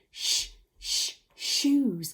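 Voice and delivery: "Shh, shh, shoes" with separated hushed onsets; two unvoiced "Shh" sounds, then "shoes" in full; sounding out sh. Shown as a demonstration. Teacher modelling for children to copy, "Shh, shh" before "shoes". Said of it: "Can you say that with me?".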